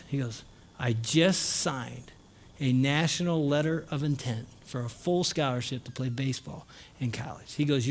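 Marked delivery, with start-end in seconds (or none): 0.8-7.5 understated acted-out announcement, dropping softer at the end; quiet reveal. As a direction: deliver big news hushed, spacing out the first words. Nephew reveals his scholarship.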